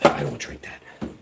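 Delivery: a soft, breathy whisper under the breath; whispered aside. Real, not acted. Muttering to the chat about the beer, whispered. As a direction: slip it in quietly as if not for the chat.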